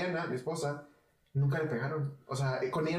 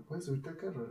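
softer, a quiet serious dad; dead serious; 2 semitones higher, 7 dB quieter than usual. Retold or imitated. Father calls son off the street, serious and soft. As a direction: quietly lay down the request. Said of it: "Bien serio".

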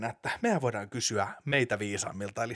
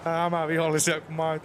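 higher voice, amused; comic hero explaining in a higher register; put-on superhero voice; 5 semitones higher than usual. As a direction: show off your higher character voice. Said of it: "eri äänillä".

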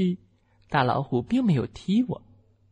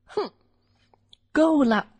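high, sharp cry of fury; angry shout; 11 semitones higher, 3 dB louder, slower than usual. Acted. Tiny mouse yelling at the big tiger, angry. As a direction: snap that you've had enough. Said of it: "我气得大声叫了起来".

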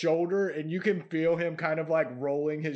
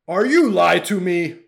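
loud troll demand; 2 semitones higher, 11 dB louder than usual. Acted. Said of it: "he goes".

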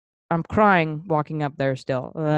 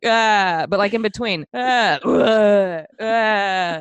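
put-on drunk crying and retching; 4 semitones higher, 3 dB louder, much slower than usual. Imitating crying while vomiting.

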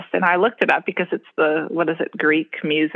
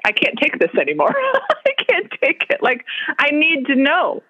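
laughing exasperation; 8 semitones higher than usual. Retold or imitated. Retold exasperation, laughing and higher.

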